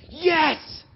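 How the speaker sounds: a sudden high, loud yes; shouted yes. Retold or imitated. Student shouting his eager yes to the pastor. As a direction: blurt it out full of joy. Paul's enthusiastic answer retold.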